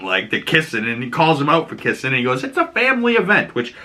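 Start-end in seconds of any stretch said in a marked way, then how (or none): 2.4-3.5 high and sneering; lightly voiced jerk from the game; mocking Seth. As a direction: needle them like a smug jerk. Seth scolding the couple.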